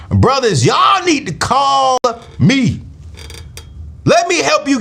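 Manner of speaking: high, drawn-out call to the brothers; preacher-style shout-out, slow and high. Real, not acted. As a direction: stretch it out and call them in. Calling out to brothers.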